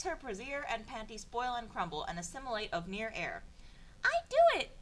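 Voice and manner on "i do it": high, loud girly mock shout; princess shout. Reading Peach's line aloud in a high, loud mock princess shout. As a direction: belt it out like a cartoon princess. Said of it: "Princess shouts like that".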